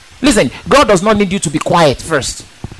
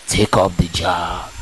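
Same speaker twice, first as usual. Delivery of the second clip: soft, low, slow whisper; devil's whisper; 10 semitones lower, 8 dB quieter, slower than usual. Retold or imitated. The devil whispers to the waiting believer, low soft and slow. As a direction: breathe the temptation low and slow. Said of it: "stay and whisper".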